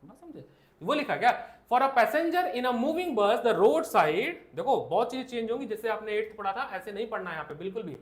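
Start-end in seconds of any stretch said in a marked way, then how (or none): 1.7-4.3 reading the NCERT line aloud word by word; drawn-out dictation to the class; slow textbook reading. Shown as a demonstration. Teacher reading the textbook line slowly to students.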